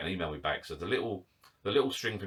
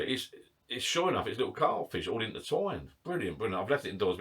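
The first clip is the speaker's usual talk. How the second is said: usual speaking voice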